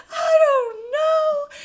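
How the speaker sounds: very high, loud, drawn-out cry; despairing wail. Retold or imitated. Answering friends' questions on speakerphone, despairing. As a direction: wail it slowly in mock despair.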